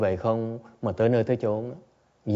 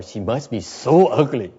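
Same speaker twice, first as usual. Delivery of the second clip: slow, louder, half laughing; laughing mock thought; 2 semitones higher, 8 dB louder, slower than usual. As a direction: giggle through a silly imagined thought. Imagined thought during meditation. (giọng nghĩ thầm vừa nói vừa cười)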